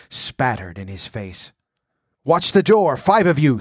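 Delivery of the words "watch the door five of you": high, loud screamed command. A narrator's reading. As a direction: scream orders over the fight. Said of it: "screamed Escalante".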